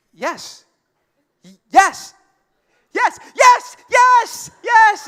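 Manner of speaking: put-on girlfriend's acceptance swelling into ecstatic yelling; imitated overjoyed yes. Girlfriend accepts, building to shrieks.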